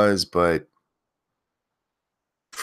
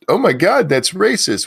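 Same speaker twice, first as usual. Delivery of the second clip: mocking outraged moron; 10 semitones higher, 5 dB louder than usual. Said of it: "they think".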